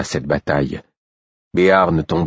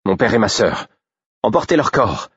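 firm order; 5 semitones higher than usual. A narrator's reading. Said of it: "ordonna Félicité".

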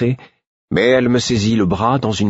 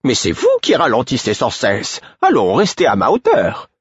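indignant high retort; sharp retort; 6 semitones higher than usual. A narrator's reading. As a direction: fire back at him in a high voice. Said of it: "rétorqua-t-elle".

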